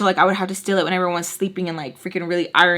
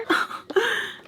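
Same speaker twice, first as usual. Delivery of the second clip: breathy giggle; 14 semitones higher, 6 dB quieter than usual. Real, not acted. Laughing at own confusion.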